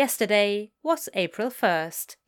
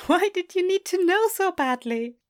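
teasing question; 10 semitones higher, 3 dB louder than usual. Acted. Amused angel teases demon.

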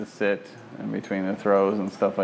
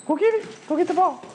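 gushing praise for the dog; excited dog praise. Real, not acted. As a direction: cheer the dog on. Sending dog to fetch.

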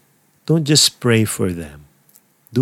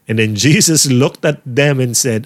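ordinary delivery

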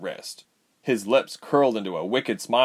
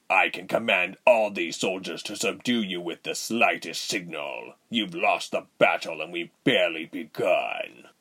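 commanding villain voice, dropping low; threatening villain; 3 semitones lower than usual. Acted. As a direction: threaten with controlled authority. Voices villain Reuben in story.

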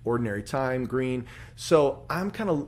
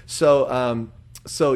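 normal talking voice

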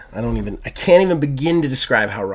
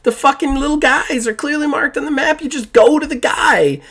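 much higher, exasperated lecture; furious explaining; 12 semitones higher than usual. Real, not acted. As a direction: podcaster scolding his co-host over open world games, high and heated. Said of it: "while I'm absolutely furious".

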